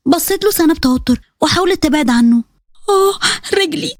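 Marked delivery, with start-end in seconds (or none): 2.9-4.0 high, drawn-out moan of hurt; cry of pain. Acted. Bride hurting her leg as she backs away, pained. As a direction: yelp slowly as if the leg just twisted.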